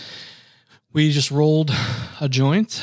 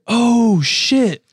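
surprised; 9 semitones higher, 4 dB louder than usual. Retold or imitated. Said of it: "I was like".

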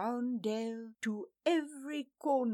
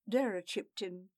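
plain reading voice

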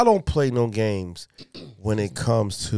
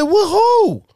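much higher, louder, startled; shocked high question; 6 dB louder than usual. Retold or imitated. Father hearing of daughter's first kiss, shocked. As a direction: yelp it out in comic alarm.

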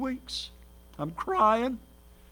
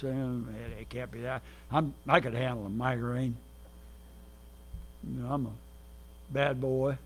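low, much softer mock swagger; tough-guy bravado; 10 semitones lower, 9 dB quieter than usual. Preacher imitating his younger self boasting to the congregation, low mock swagger. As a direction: play your old cocky self shrugging off pain. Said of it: "I think".

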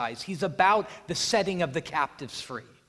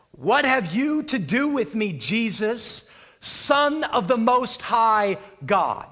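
loud, higher cry, slowing at the end; demoniac crying out; 4 semitones higher, 6 dB louder, slower than usual. Acted. Possessed man crying out to Jesus, loud and pleading. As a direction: cry out to Jesus from his knees. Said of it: "Crying out with a loud voice".

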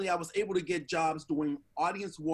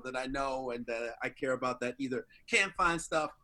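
ordinary talk

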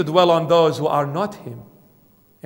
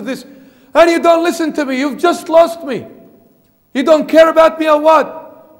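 rising to an indignant, higher, louder protest; a believer who feels ignored, pleading then indignant; jealous complaint; 5 semitones higher, 3 dB louder than usual. Retold or imitated. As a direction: build from hurt questioning to an exasperated outburst.

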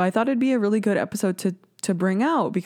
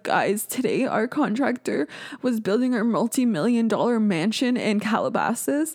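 higher-pitched influencer parody; imitated family-vlogger voice, climbing higher; mock Ace family vlog; 5 semitones higher than usual. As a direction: mimic a whiny rich vlogger complaint, pitched up. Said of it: "when the Ace family is like".

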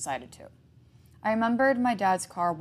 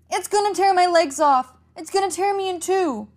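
much higher, louder terrified scream; screaming in agony; 12 semitones higher, 9 dB louder than usual. A narrator's reading. Boy held by the creature screams in agony. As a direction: scream as if being torn apart. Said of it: "Jack screamed in agony".

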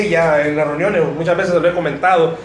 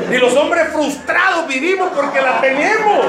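ordinary talk, nothing marked

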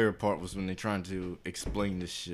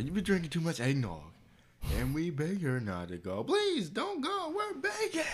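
squeaky high begging voice, pitched way up; high pleading family; 9 semitones higher than usual. Retold or imitated. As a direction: beg like a desperate family in a cartoon. Acting out family begging grandma.

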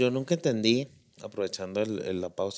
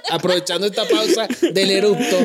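laughing; 6 semitones higher, 5 dB louder, slower than usual. Real, not acted. Laughing about the burp.

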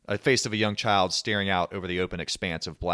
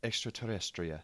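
softly attempted Spanish pronunciation; Spanish accent; 11 dB quieter than usual. Shown as a demonstration. Co-host attempts extraterrestrial in Spanish, quiet Spanish accent. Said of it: "what's extraterrestrial in Spanish".

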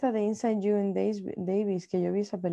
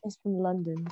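softer imitated London accent; 6 dB quieter, slower than usual. Shown as a demonstration. Friend echoes the London accent back, softly. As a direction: echo it in a London English accent. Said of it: "¿será que tiene un acento así?".

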